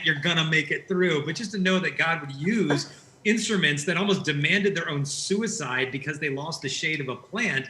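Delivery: usual speaking voice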